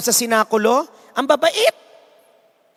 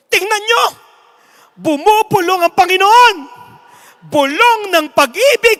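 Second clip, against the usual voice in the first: high, loud, swelling proclamation; hammed-up loud, high announcer frenzy; fervent announcer; 8 semitones higher, 7 dB louder than usual. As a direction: whip the crowd into tears. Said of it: "Sabi ng announcer".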